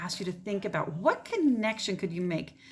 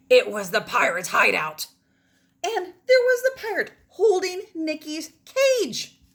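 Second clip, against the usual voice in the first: put-on expressive read-aloud for children; story narration; 6 semitones higher, 6 dB louder than usual. Teacher reading a story to her class, expressive read-aloud. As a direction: tell it like a bedtime adventure.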